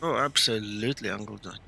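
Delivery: usual speaking voice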